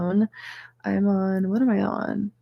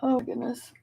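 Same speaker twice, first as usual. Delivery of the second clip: normal talking voice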